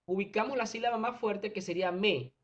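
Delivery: usual speaking voice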